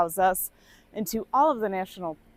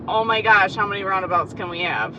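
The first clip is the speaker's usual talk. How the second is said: loud, giddy disbelief; mock giddy; 8 dB louder than usual. Acting out being stuck among endless roundabouts, mock giddy. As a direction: play it overwhelmed and giddy. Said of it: "This is, like, giddy".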